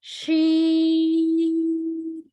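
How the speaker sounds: शीन held long; long sheen. Shown as a demonstration. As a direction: stretch शीन slowly. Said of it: "इस तरह की sound".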